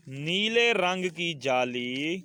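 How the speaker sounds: half-speed classroom reading, rising at the end; drawn-out words for the class to echo; slow model reading. Shown as a demonstration. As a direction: read slowly and leave it open for pupils. Reads sentence for class to repeat.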